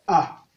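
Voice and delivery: A on a short low tone. Shown as a demonstration. Teacher showing the tones, A on low tone. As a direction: drop A to the low tone. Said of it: "ton bas".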